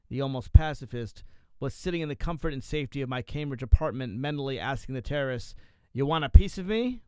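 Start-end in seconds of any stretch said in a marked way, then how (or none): 5.9-7.0 louder, higher taunting question; mock challenge. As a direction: throw it out as a dare. Acted challenge to the terrorists.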